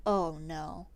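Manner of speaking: low, hushed sinking realization; quiet dread. Retold or imitated. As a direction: mutter it low with dawning dread. Dread on hearing he is minister.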